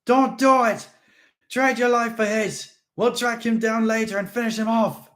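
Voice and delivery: high, loud pleading; urgent shouting. Acted. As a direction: beg him across the gap.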